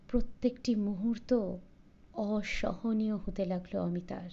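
plain reading voice

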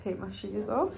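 ordinary delivery

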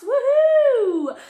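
high, loud whoop; excited cheer. Real, not acted. Cheering a child's arrival.

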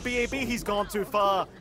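ordinary talk

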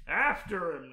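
loud, high urgent yell; sudden shouted command to give chase; screamed. A narrator's reading. Naruto rushing towards the portal, screamed. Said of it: "Naruto screamed as he".

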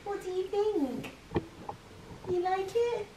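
pet talk. Real, not acted. Talking to the pet dog.

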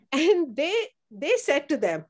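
usual speaking voice

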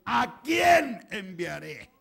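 loud, very slow, ringing call; drawn-out booming question; voice of God. Acted. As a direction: call out solemnly, stretching every word. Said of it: "oyó una voz".

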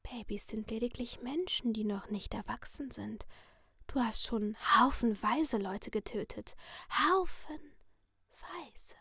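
sinister masked figure in a soft whisper; menacing whisper from behind a mask; creepy whisper. Acted. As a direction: purr the threat like a friendly killer. Letty whispers.

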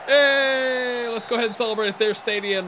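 long high shouted hey, easing down as it goes; loud, high cheer settling into wry chat; celebratory cheer. Real, not acted. Gamer celebrating the title at the final whistle, cheering.

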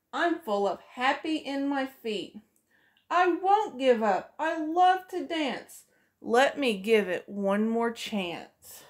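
usual speaking voice

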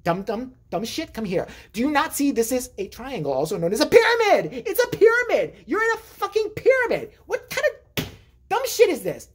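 high, rising exasperated scolding; exasperated rant. Retold or imitated. Big brother berating his brother over the pyramid chart, exasperated. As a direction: lose it at your clueless brother.

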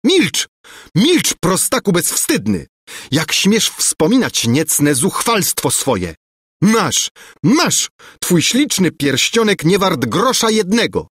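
high, furious yell; screaming. A narrator's reading. Angelika screams at the prince to be silent, high and furious. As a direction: shriek him into silence. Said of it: "rzuciła się ku Lulejce wrzeszcząc".

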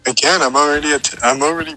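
loud refusal. Acted. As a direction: yell that you can't.

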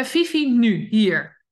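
loud impatient dog owner's command; stern command. Shown as a demonstration. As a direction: bark the order at the dog. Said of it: "die beginnen eigenlijk zo".

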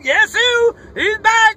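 usual speaking voice

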